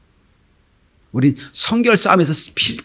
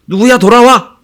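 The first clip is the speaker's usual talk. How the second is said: raised, louder call home; mother calling; 8 semitones higher, 6 dB louder than usual. Acted. Preacher replays mother summoning her child, calling out. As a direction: call out to a child still at play.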